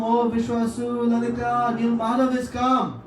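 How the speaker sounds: calling out. Retold or imitated. Announcing loudly to hidden devotees.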